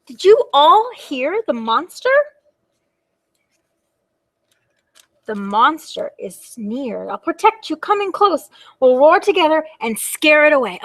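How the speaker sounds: play-acted protective urgency; brave little dragon, rallying. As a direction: gather everyone to face the monster. Little dragon rallies the children.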